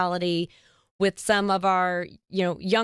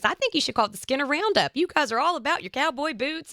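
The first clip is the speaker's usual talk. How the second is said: lightly voiced sweet friend, higher pitched; a hint of Peg Bender, higher and sweet; sweet friend's suggestion; 7 semitones higher than usual. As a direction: lift the voice, kind and encouraging. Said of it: "She goes".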